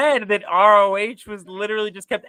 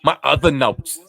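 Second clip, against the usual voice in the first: mimicking a thick Brooklyn "other"; Brooklyn accent; 3 semitones lower, 3 dB louder than usual. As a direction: say "other" like a New Yorker. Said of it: "That other was real Brooklyn to you".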